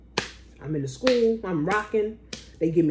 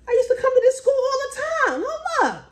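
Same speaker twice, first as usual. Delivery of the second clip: high, squeaky double take; suddenly recognizing the school, high and startled; surprised realization; 4 dB louder than usual. Retold or imitated. Driving up to her daughter's old school, high surprise.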